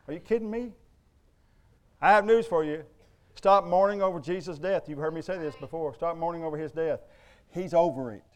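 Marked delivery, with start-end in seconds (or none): none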